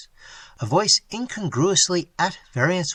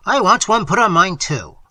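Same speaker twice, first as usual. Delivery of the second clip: loud, higher outburst; crying out; 5 semitones higher, 7 dB louder than usual. A narrator's reading. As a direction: cry it out in protest. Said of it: "cried Quint".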